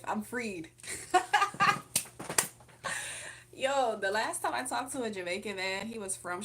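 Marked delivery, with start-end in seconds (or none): 0.7-3.5 loud, high, long laugh; real high-pitched laughing fit. Real, not acted. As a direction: crack up loudly at your own past. Laughs at her own past era.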